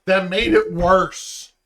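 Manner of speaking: loud, slow and pained. Real, not acted. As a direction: drag it out in pain. Burning mouth from sauce.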